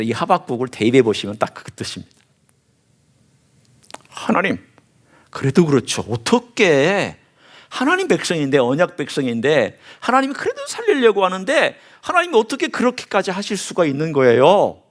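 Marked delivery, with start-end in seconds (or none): 4.1-14.8 higher, incredulous protest; challenging God. Retold or imitated. Habakkuk challenging God about His own people, incredulous. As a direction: press God with disbelieving questions. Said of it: "하나님께 도전하고 의문을 제시하고".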